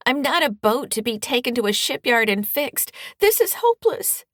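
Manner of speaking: loud, high, wailing cry of despair; high-pitched wail. Acted. Daughter cries out to her mother, a loud high wail. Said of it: "she wails in a high-pitched voice".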